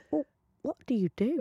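put-on a sweet old lady asks gently; sweet, soft stranger. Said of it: "She sounds so sweet".

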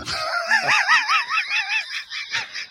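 howling high-pitched laugh that shoots way up; big burst of laughter. Real, not acted. Host cracking up at a cheeky listener question, howling laugh. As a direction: throw your head back and howl with laughter.

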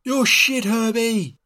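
high, drawn-out mock crowd call; high-pitched heckle, only half-shouted; imitated heckler's yell. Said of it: "yelling out his name and".

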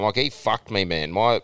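ordinary talk

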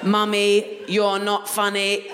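put-on slow robot voice of a toddler; flat slow robotic toddler demand. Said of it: "in a robot voice".

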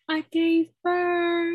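high, slow, dazed and elated; drawn out like someone floating on meds; high drugged self. Retold or imitated. New mother on drugs announces the birth, high and slow. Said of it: "I was like".

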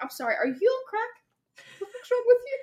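rising outrage at a silly card; incredulous. Pretend. Imagined listener shocked by the flowery card, high and outraged.

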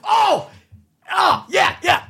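real surprised cry; sudden realization. Real, not acted. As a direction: yelp as it comes back to you. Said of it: "sudden memory".

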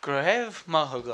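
"Grev Mahagut" slowly copied, a little uncertain; careful imitation. Host copying the guest's Irish thanks, "Grev Mahagut" slow and careful. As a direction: echo "Grev Mahagut" slowly after the speaker.

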